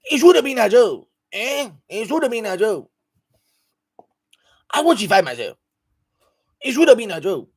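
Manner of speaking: high, punchy mock Nigerian accent; Lagos accent. Shown as a demonstration. Showing a requested accent to the stream, hammed-up Lagos accent. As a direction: put on an emphatic Lagos accent. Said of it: "Lagos accent".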